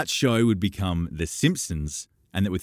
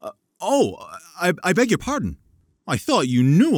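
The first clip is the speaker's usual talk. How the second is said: much higher, louder, tripping over the words; Gatsby embarrassed his guest did not know him, flustered; flustered apology; 11 semitones higher, 5 dB louder than usual. A narrator's reading. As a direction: caught off guard, hurry to apologize.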